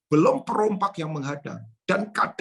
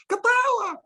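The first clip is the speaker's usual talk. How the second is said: high, ringing call of "Ketawa."; bright exclaim; slower than usual. Shown as a demonstration. Preacher urging the congregation to laugh, high and ringing. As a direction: sing out "Ketawa." as a command to laugh. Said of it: "Ya kan?".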